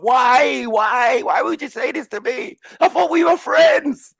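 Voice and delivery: high, loud hurt pleading; hurt child. Retold or imitated. Young boy pleading with a friend who insulted him, high and hurt. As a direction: cry it out like a betrayed eight-year-old.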